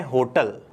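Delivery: wrong-style होटल, stress on HO; Hindi pronunciation. Shown as a demonstration. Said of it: "Hindi में अपन इसको कहते हैं".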